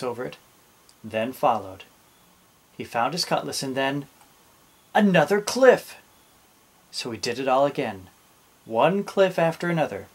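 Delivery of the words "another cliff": higher, louder burst of discovery; surprised reveal. A narrator's reading. Captain finds yet more cliff, surprised. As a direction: punch it as a sudden surprise.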